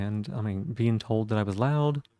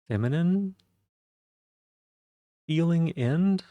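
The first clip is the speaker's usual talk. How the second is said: higher, lighter and drawn out; feminine lift; 7 semitones higher, 4 dB louder, slower than usual. Shown as a demonstration. Streamer showing the feminine ending she reaches for, higher. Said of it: "I may be doing that because it is a more".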